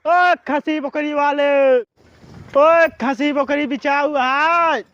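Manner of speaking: loud, very drawn-out vendor call; slow, carrying hawker's shout; street hawker's cry. Acted. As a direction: stretch the cry long down the lane. Goat buyer crying through the village.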